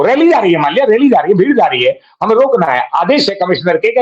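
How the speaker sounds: ordinary delivery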